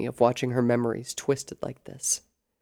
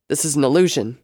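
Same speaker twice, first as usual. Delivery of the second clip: firm, louder insistence; defiant thought; 7 dB louder, faster than usual. A narrator's reading. Sable telling herself the visions are fake, defiant. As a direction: insist it with force.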